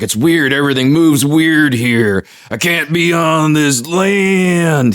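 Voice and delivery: strained mariner impression, stretching out the last word; Kevin Costner. Mariner who can't stand being on land, badly done Costner impression. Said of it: "Why does your Kevin Costner sound like".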